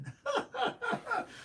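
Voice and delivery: a real laugh that jumps up high; high burst of laughter. Real, not acted. Laughing at his own Nino Brown bit, high real laugh. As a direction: break into a high-pitched laugh.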